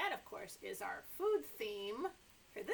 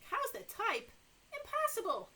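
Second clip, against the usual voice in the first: higher, louder cry of disbelief; disbelieving farmer; 10 semitones higher, 5 dB louder than usual. Acted. Farmer reacts to typing cows, disbelieving cry.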